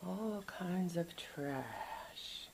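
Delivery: slow hushed whisper. Real, not acted. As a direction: whisper it slowly.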